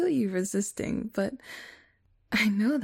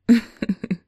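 evil cackle; 11 dB louder than usual. Acted. Evil gloating laugh.

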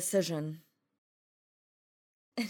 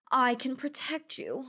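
normal talking voice

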